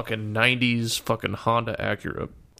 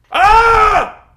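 huge high frustrated scream; 14 dB louder than usual. Retold or imitated. Boyfriend driven insane by his girlfriend, a loud high frustrated scream.

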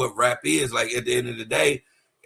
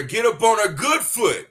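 quoting [name]'s diss line; 7 semitones higher, 4 dB louder than usual. Retold or imitated. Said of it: "told her".

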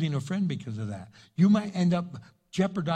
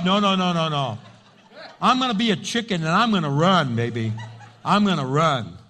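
laughing retreat; 7 dB louder than usual. Acted. Acting scared, laughing.

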